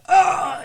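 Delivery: high, sudden mock groan of pain; heart-attack groan. Host acting out the father's fatal heart attack, mock groan. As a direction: imitate a man seized by chest pain. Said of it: "he has, like, a".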